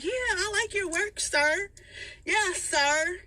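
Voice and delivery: high, mocking polite sir; fake polite. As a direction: overdo the respect to taunt. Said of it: "And I'm like".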